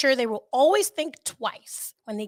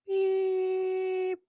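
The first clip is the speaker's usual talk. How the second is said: flatline beep; 9 semitones higher than usual. Retold or imitated. Flatline beep as cardiac arrest.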